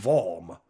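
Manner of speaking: "warm" said with a v, like "vorm"; accented "warm". Acted. Streamer reading a viewer's message, accented "warm". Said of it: "you said it in a, in an accent".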